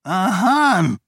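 put-on a startled old duke calling out; shouted name. A narrator's reading. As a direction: cry out the name in alarm. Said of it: "rief Roial".

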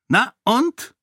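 cold dismissal. A narrator's reading. The king coldly shrugs off Sarene's accusation. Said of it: "fragte er kalt".

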